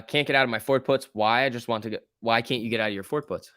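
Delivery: usual speaking voice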